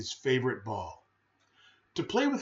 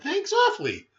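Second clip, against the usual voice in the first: put-on much higher, startled boy's voice; startled thanks; 14 semitones higher than usual. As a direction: blurt it out in surprise. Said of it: "the boy said, startled".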